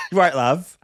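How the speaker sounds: playful mock English accent; casual English accent, lifted at the end. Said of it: "I gotta work on my English".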